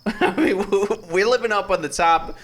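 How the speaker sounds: laughing while talking; giggling through the words. Real, not acted. Laughing at own boast.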